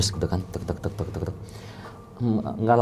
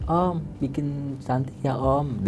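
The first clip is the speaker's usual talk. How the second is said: put-on higher, soft, drawn-out child voice; small child's voice; 4 semitones higher, 6 dB quieter, much slower than usual. Possessed bride begs the makeup uncle, in a child's voice. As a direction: plead slowly like a little kid. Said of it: "dia berubah jadi anak kecil suaranya".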